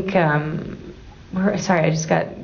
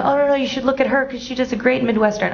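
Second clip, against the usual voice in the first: pushy agent; 8 semitones higher, faster than usual. Retold or imitated. Imitating pushy casting suggestion.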